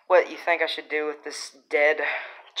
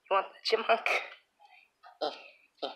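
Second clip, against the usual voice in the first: ordinary talk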